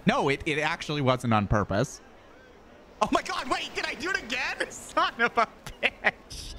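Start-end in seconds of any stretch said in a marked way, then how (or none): 3.0-6.5 high, laughing fluster at catching yourself; real laughter pushing the voice up high; laughing disbelief. Real, not acted. Embarrassed at catching own uptalk, laughing.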